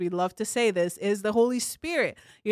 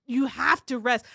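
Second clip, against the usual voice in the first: ordinary talk, nothing marked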